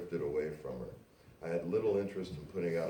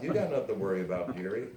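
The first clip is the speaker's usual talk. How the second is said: laughing; 3 semitones higher, 6 dB louder, faster than usual. Acted. Laughing reply, fast.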